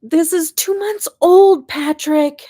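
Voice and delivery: high, loud, slow teasing comeback to dad; exasperated retort. Retold or imitated. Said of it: "And I'm like".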